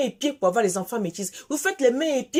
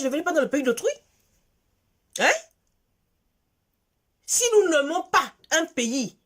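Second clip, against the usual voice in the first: normal talking voice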